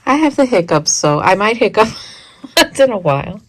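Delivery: laughing through the words. Real, not acted. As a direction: chuckle while you say it. Laughs at her own blooper.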